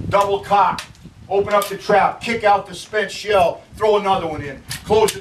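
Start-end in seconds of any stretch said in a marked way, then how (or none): none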